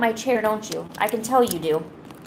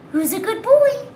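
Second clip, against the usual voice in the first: pet voice; 11 semitones higher, 4 dB louder than usual. Real, not acted. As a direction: coo it to your cat. Pet talk to cat.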